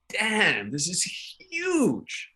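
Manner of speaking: high, drawn-out amazement on "huge"; awestruck. Retold or imitated. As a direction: stretch it out, amazed at the sheer size. Acting out his awe at London's size.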